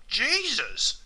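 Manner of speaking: high, falling startled oath; drawn-out cry of disbelief; surprised or angry exclamation. Acted. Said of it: "when they're surprised or angry".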